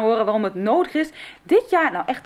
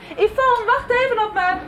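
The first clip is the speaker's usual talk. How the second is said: calling out; 10 semitones higher than usual. Acted. Calling out to a friend.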